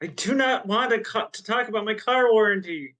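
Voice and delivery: high-pitched, hammed-up complaint; mock protest. Game master refusing car warranty junk mail, mock protest. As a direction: whine it in playful indignation.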